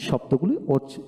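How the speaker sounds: normal talking voice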